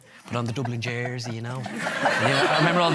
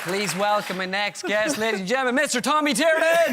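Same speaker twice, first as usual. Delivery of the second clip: Patrick Keeley impression; 8 semitones higher, 4 dB louder than usual. Mock host announces his next guest, building higher. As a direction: build the guest introduction up high. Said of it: "I'm your host, Patrick Keeley".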